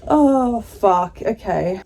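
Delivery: usual speaking voice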